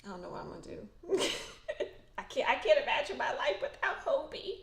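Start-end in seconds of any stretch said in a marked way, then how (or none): 0.9-2.1 teary laugh. Real, not acted. Tearful laugh about missing [name]. 2.2-4.6 high, laughing, near tears; laughing through tears. Real, not acted. Fan missing [name], laughing through tears.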